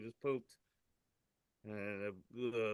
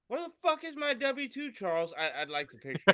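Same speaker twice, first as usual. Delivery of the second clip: attempted English accent; 10 semitones higher, 4 dB louder than usual. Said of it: "I don't have my good English accent going yet".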